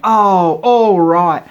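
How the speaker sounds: loud, high, worn-down oh all right; exasperated giving in. Retold or imitated. Stepdad finally giving in to mum, loud and exasperated. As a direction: cave in with a loud sigh of defeat.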